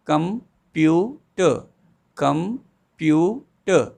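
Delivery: "computer" slowly, pausing at each of its three beats, com-pu-ter; "computer" drawn out, three separate beats; syllables spelled out slowly. Shown as a demonstration. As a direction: break "computer" into three halts. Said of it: "There are three halts".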